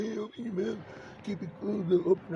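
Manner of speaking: high-pitched put-on voice; taunting voice. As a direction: mimic a pestering voice, pitched well up. Imitating the voices talking at him.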